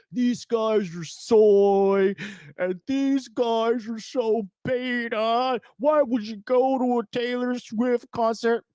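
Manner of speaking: high, drawn-out mocking whine; mock whining. Imitating guys bitching online about [name] concertgoers, mock whining. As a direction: whine it slowly like a bitching complainer. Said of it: "bitching, and they're like".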